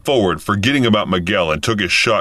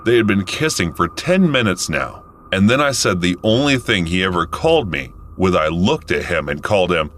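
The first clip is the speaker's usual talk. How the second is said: ordinary talk, nothing marked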